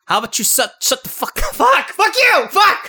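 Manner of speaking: strained squeaky high voice, cracking into laughter; a botched accent attempt going shrill; failed South African accent. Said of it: "What the hell was that?".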